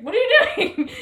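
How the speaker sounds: very high, loud mock freak-out; hammed-up panicked disbelief; imitated alarm. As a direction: shriek it as the appalled partner. Retold partner's alarmed reaction.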